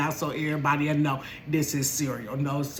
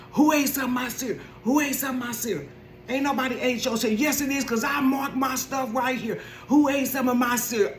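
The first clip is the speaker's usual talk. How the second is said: higher, upset demand; ranting son; 7 semitones higher than usual. Retold or imitated. Son ranting through the house about his cereal, higher. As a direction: rant like a wronged kid. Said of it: "came running and ranting through the house".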